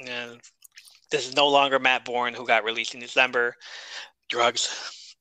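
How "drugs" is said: normal talking voice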